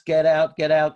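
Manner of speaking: loud, punched repeated command; urgent call. Real, not acted. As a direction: hammer out each urgent command loudly.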